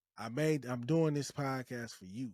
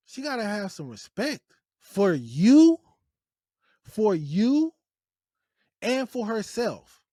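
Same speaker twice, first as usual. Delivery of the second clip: much louder and higher, insistent; emphatic shout; 6 semitones higher, 12 dB louder than usual. Real, not acted. Host lecturing listeners on choosing a wife, loud. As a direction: hammer the point about respect.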